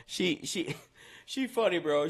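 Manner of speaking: giggling through the words; can barely talk for laughing. Real, not acted. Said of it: "I can't stop laughing".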